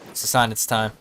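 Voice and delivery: usual speaking voice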